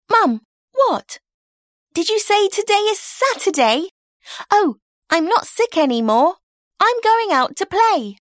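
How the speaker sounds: put-on excited girl ready to play; sudden excited girl. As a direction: burst into delight, suddenly well. May hears it is Saturday.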